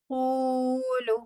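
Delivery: कूलू very slowly with both ू sounds held; long vowels held. Shown as a demonstration. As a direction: silent alif, stretch the madd. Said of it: "इस अलिफ को भी हम नहीं पढ़ेंगे". (कूलू को धीरे, लंबे ऊ के साथ)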